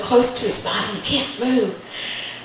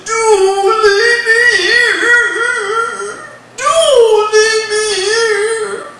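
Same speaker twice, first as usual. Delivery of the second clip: high, loud, very drawn-out wail; bellowing; 10 semitones higher, 7 dB louder, much slower than usual. Acted. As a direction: bellow like a trapped giant, stretching every word. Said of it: "he's bellowing".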